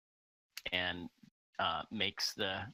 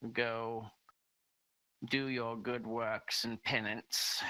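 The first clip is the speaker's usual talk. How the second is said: higher-pitched, measured lord's voice; lordly father; 4 semitones higher than usual. Acted. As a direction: pronounce it like a lord dismissing a petitioner, pitched up.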